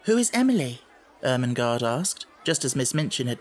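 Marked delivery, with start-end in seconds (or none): 0.1-0.8 put-on curious girl. A narrator's reading. Ermengarde asks curiously.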